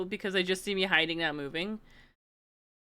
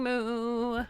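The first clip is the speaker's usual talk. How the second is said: usual speaking voice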